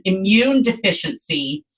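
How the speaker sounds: normal talking voice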